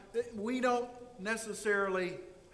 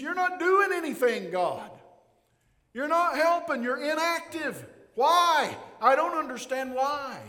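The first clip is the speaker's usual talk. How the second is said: loud, much higher outcry; anguished protest; 6 semitones higher, 6 dB louder than usual. Acted. Habakkuk accusing God of doing nothing, loud outcry. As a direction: demand an answer from God. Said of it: "he means".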